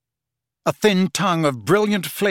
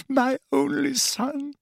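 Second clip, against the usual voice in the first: 1 sobbing; 12 semitones higher than usual. A narrator's reading. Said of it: "sobbing and moaning".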